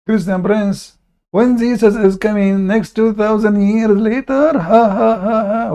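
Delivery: mock the claim and laugh at it. Real, not acted. Mocking opponent's claim, laughing.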